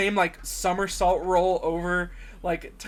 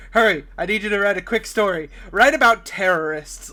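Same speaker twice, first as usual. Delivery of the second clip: louder, mock-urgent newspaper boss; 2 semitones higher, 6 dB louder than usual. Boss handing Clark a quick story, mock urgent. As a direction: bark out the assignment as an imitated boss.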